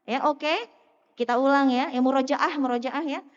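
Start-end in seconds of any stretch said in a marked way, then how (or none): none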